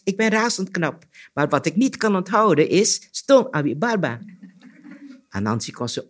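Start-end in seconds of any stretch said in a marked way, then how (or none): 0.0-4.2 high-pitched, boastful deer; deer's voice. A narrator's reading. Deer brags to Anansi, high boastful voice. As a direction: boast in a raised, lively pitch.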